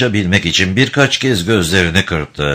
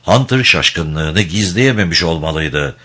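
usual speaking voice

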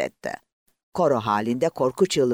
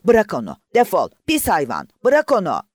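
much louder and higher, a furious yell; shouting; 7 semitones higher, 8 dB louder than usual. A narrator's reading. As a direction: drive the beast off with a shout. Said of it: "sopayı savurup ona haykırdı". (hayvana öfkeyle bağırarak)

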